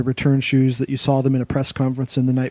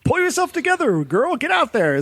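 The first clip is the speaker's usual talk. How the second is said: pep talk; 13 semitones higher than usual. Retold or imitated. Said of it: "She's like".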